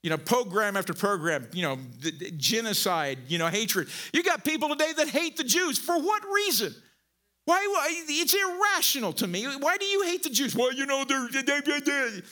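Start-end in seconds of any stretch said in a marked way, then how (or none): 4.1-10.5 high-pitched, incredulous outburst; indignant preaching. Real, not acted. 10.5-12.2 high, garbled imitation of excuse-making; mock someone floundering for reasons, pitched high; mocking stammer. Preacher imitating haters with no answer, mocking stammer.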